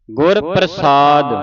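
ਗੁਰ ਪ੍ਰਸਾਦ slow and loud, long ਸਾ; correct pronunciation. Shown as a demonstration. Teacher modelling scripture for children, slow and emphatic. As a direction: separate ਗੁਰ, stretch ਪ੍ਰਸਾਦ. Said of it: "ਇਸ ਤਰ੍ਹਾਂ ਉਚਾਰਨ ਕਰਨਾ ਹੈ".

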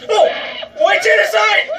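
high, loud outburst; excited shout. Real, not acted. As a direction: yell it in wild excitement. Excited yell during kills.

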